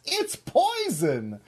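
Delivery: high, slow, sing-song cartoon reveal; hammed-up little critter's punchline; Mister Mouse. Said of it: "And Mister Mouse says".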